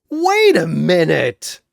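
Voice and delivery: head snapping up with an idea; sudden realization. Acted. Shazam suddenly realizing the trick, exclaiming loudly.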